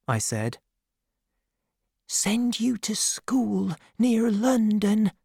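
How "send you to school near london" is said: high, slow whisper. A narrator's reading. Peggotty tells Davy about school through the door, whispering. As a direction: whisper it slowly in a high woman's voice. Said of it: "she whispered".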